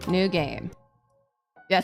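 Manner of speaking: usual speaking voice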